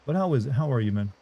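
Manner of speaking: ordinary delivery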